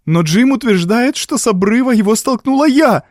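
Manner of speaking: high, agitated woman's outcry; distressed exclamation. A narrator's reading. Evelyn protests to the doctor, exclaiming high and distressed. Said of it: "воскликнула Эвелин".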